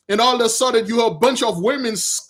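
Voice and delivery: ordinary talk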